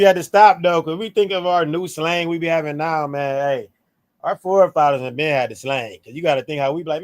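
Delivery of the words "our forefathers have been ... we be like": ordinary talk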